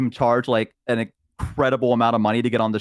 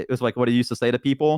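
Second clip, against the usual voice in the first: ordinary talk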